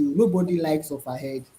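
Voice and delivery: normal talking voice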